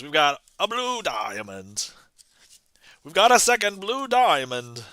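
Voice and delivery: showman's card pitch in a put-on vintage announcer accent; 1920s radio announcer. Magician has viewers guess the red diamond, old-time radio announcer voice. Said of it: "am I like a radio announcer".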